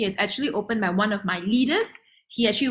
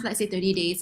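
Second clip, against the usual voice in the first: ordinary talk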